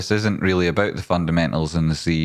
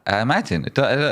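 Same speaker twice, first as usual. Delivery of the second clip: ordinary talk, nothing marked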